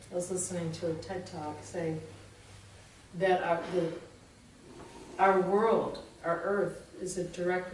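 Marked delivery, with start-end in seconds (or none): none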